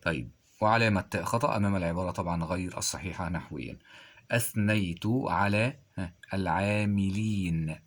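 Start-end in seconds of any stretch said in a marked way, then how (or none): none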